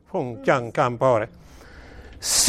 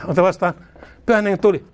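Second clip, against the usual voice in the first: ordinary talk, nothing marked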